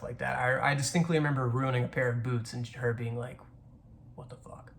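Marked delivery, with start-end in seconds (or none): 4.2-4.7 barely voiced, whispered disbelief; hushed exasperation. Mom seeing her kid's ruined boots, hushed exasperation. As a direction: imitate a mom under her breath.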